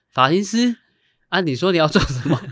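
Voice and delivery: high, loud disbelieving repeat; incredulous. Retold or imitated. Teacher on the phone, stunned by the hairdresser, loud disbelief.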